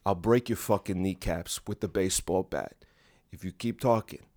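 mobster voice. Pretend. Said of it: "I don't do that voice a lot".

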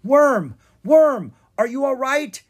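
high, loud frightened calls; worried cry. Acted. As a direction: cry out to your hurt dragon. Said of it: "Drake cried".

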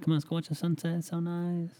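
high mock-girly nagging; girlfriend voice. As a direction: whine it in a high put-on voice. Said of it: "girlfriend voiced in a story".

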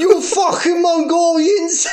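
high, slow mock-angry Chinese accent; hammed-up outrage in Chinese caricature; South Park restaurant owner. Cartoon owner curses Mongolians, mock Chinese accent. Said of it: "the voices from South Park".

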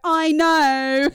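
put-on a cocky kid shrugging it off; son's cheeky reply. Mum imitates her son brushing off her love you. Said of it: "And he'll go".

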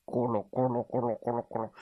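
breathy rumbling ku-lu sounds; bear tummy rumble. A narrator's reading. Narrator imitates a hungry bear's rumbling stomach, soft gurgle. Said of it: "咕噜咕噜的声音".

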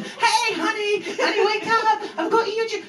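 very high sing-out to wake someone; hammed-up eager boyfriend, high and chirpy; bounding-in excitement. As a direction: play full of beans, way up in pitch. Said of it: "like, bounded in".

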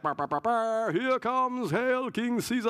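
a hint of a Roman herald crying out; herald announcing Caesar. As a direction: trumpet in a royal arrival like a town crier. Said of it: "So it's like".

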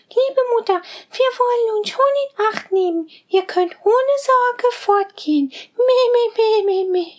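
put-on high, bleating kid-goat voices; little goat kids. Little goats promising their mother to be careful, high and bleating. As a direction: chirp it high like tiny goats.

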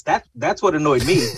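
ordinary delivery

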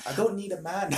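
rough London chav voice; chav accent. Retold or imitated. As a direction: do a chav mum. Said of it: "chav accent".

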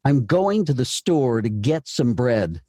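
slow, weight on "going", "store", "get", "bread"; deliberate demo stepping from verb to noun; stress on verbs and nouns. Shown as a demonstration. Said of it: "concentrate on verbs and nouns".

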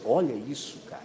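ordinary delivery

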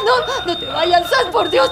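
ordinary delivery

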